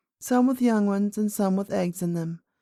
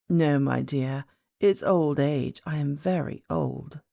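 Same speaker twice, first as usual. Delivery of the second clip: put-on low, slow old lady voice; an aged great-grandmother gently explaining; 5 semitones lower, slower than usual. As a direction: play her old and unhurried, pitched down.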